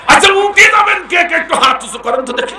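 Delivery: high, loud call to the crowd. Real, not acted. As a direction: rouse the audience to raise hands. Calling out to crowd.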